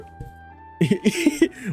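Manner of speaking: high giggle breaking out; snickering laugh. Real, not acted. Streamer pitching a sock as actress, giggling. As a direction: giggle before you can finish the idea. Streamer cracks up at own idea.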